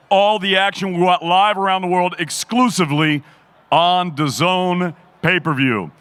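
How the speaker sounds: ordinary talk, nothing marked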